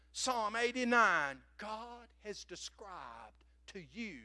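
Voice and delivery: normal talking voice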